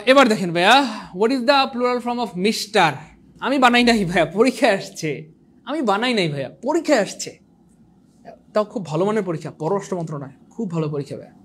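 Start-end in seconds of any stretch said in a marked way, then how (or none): 5.6-7.5 mock innocent voice. Teacher jokingly repeats to students he didn't write it, mock innocent voice. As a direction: play it like a cheeky kid pleading innocence.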